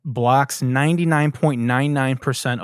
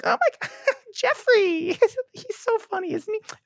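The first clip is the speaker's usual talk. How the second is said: very high gushing mom voice; shrill-high cooing parent parody; annoying mother. Retold or imitated. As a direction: ham up a doting new mother bragging. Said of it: "annoying mothers who are just like".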